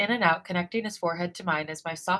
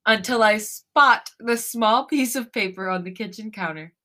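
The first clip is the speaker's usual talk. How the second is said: laughing while reading; 3 semitones higher, 7 dB louder than usual. Real, not acted. Reading through lingering laughter.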